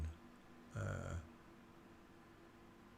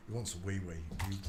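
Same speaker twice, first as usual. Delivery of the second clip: normal talking voice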